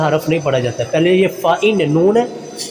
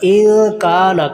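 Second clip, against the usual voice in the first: इन काना slow, kaa held long; lengthened kaf; much slower than usual. Shown as a demonstration. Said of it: "काफ को लंबा करेंगे". (इन काना में 'का' लंबा)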